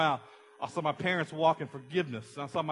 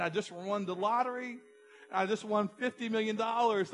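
pitched higher, bursting with lottery news; mock excited; 7 semitones higher than usual. Pastor blurting his lottery win to Brian, mock excitement. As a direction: gush like you just won big. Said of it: "I was so excited".

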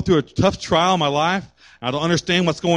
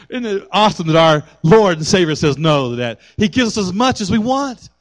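ordinary talk

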